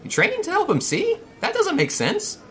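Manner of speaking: very high, loud puzzled falsetto; high-pitched tour guide, baffled; Cap. Acted. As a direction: question it in a strained high character voice. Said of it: "let's see if I can do his voice".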